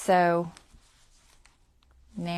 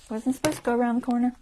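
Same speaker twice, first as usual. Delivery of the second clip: ordinary delivery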